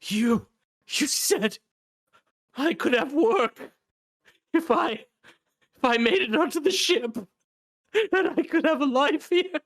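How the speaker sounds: high, loud whiny plea; pleading crippled man. Acted. Crippled man accusing Lorne of broken promises, high pleading. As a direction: beg him in a high, strained voice.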